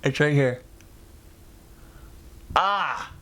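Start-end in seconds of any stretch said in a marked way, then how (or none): 2.5-3.1 short, higher-pitched "ah" of dismay; distressed cry. Real, not acted. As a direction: let out a pained, frustrated cry. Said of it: "freaking out, man".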